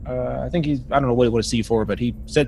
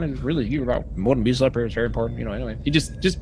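usual speaking voice

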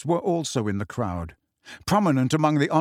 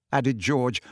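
ordinary delivery, nothing marked

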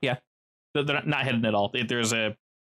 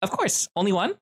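put-on high, bright young man's voice, much higher; pitched-up friendly counter guy; cheery young pizza worker; 9 semitones higher than usual. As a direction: greet the customer eagerly.

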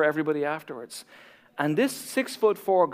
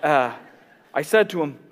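ordinary delivery

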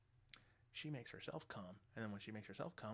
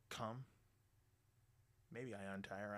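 normal talking voice

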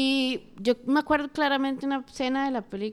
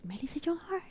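hushed whisper of the name; 5 semitones higher, 10 dB quieter than usual. Real, not acted. Drops to a whisper.

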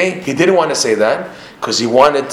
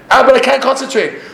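high-pitched mock protest; acted high complaint; protesting objector; 12 semitones higher, 3 dB louder than usual. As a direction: plead an excuse, pitched way up. Voices an objecting student.

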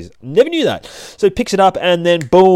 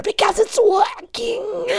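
high hero shout; slower than usual. A narrator's reading.

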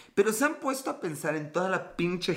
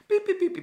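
much higher squeaky little-dog yaps; high mock yap; 3 dB louder, faster than usual. Imitating people's little dogs, high squeaky yaps.

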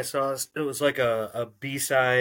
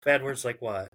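ordinary delivery

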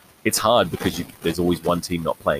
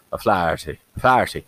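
O'Flaherty slurred to "uh-FLAT-ee", Irish lilt; O'Flaherty mumbled fast as "uh-FLAT-ee"; drunken Irish accent. Shown as a demonstration. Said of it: "You have to kinda just drunkenly go".

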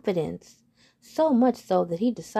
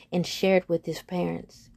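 ordinary delivery, nothing marked

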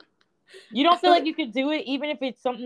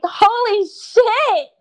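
loud, high, drawn-out exclamation; stunned reaction; 12 semitones higher, 10 dB louder, much slower than usual. Retold or imitated. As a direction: reenact the shock, stretching it out high. Retells reaction to horoscope.

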